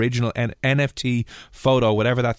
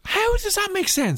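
incredulous; slower than usual. Real, not acted. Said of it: "disbelief at friend".

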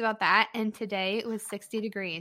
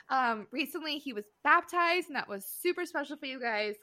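ordinary talk, nothing marked

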